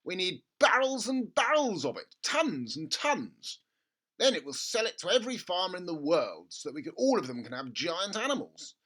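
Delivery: gushing over a money-making idea; excited father. A narrator's reading. Father urging his son at breakfast, excited. Mr Cranky voiced.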